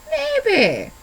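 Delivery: high sing-song funny voice, falling. Shown as a demonstration. Vlogger teasing YouTube viewers, funny voice. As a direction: pitch it way up, comic and playful. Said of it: "do it in a funny voice".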